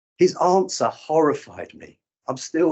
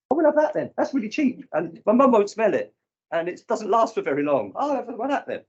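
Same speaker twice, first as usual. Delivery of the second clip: put-on higher, keen kid voice picking a bargain; mock eager kids; 8 semitones higher than usual. Kids at a youth club eyeing the drug box, high and eager. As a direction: sound like a child choosing sweets.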